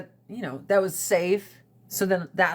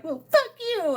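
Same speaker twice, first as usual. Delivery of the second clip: higher mock teen snap back; bratty teen comeback; 10 semitones higher than usual. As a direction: toss it back like a bratty kid. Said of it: "And I was like".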